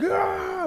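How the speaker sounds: high mock scream; strained high yell. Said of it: "and I'm screaming".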